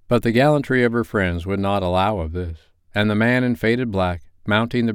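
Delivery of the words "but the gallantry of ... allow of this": ordinary delivery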